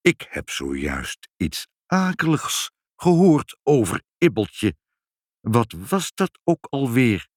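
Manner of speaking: slow, deliberate recollection; stately old man, slowly recalling; pondering old Sinterklaas. A narrator's reading. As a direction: drag the words out while trying to remember. Sinterklaas trying to remember.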